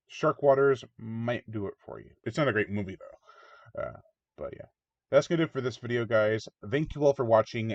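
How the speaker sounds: ordinary talk, nothing marked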